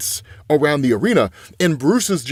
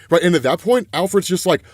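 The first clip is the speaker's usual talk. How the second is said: ordinary delivery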